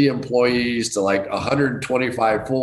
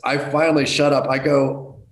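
ordinary talk

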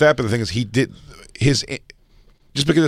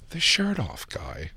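higher, softer, drawn-out mock passerby; passerby's call; 6 semitones higher, 10 dB quieter, much slower than usual. Comic imitates passersby recognizing him, soft drawn-out mock call. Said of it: "people walk by and they go".

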